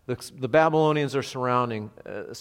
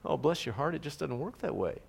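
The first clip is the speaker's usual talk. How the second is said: soft-hearted sympathy; 3 semitones higher, 7 dB quieter than usual. Retold or imitated. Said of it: "because you're soft-hearted".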